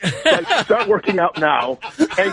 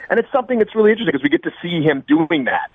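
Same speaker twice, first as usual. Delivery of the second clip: ordinary talk, nothing marked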